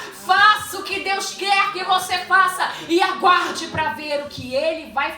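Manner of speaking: loud, high, driving sermon; impassioned preacher at full volume, stretching the climax; full-force preaching. Acted. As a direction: proclaim it to the whole church.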